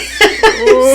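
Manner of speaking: high unvoiced laugh bursting out; burst of laughter. Real, not acted. Laughing to friends at the fishing story. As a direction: laugh breaking out mid-story.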